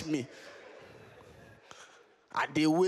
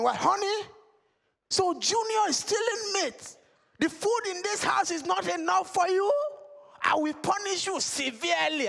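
much higher mock-stern dad; high, theatrical father scolding; 12 semitones higher than usual. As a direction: lay down the punishment like a pompous father. Father addresses wife and son.

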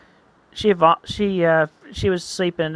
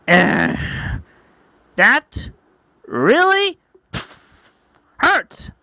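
loud, halting high outburst; pained anger; 9 semitones higher, 4 dB louder, much slower than usual. Acted. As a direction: force out each word separately, loud and rising. Punchy reacts to torn tail.

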